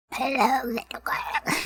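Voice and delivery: raspy, whispery creature voice; Gollum. Acted. Player tries out an absolutely horrible voice, creepy croak. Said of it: "Just start talking like Gollum".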